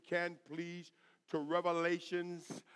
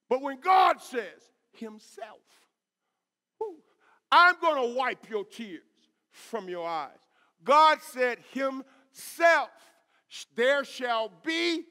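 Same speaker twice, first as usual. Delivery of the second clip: loud, raised-pitch pulpit proclamation; full-force preaching; 4 semitones higher, 9 dB louder, slower than usual. Real, not acted. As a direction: drive each phrase home to the congregation.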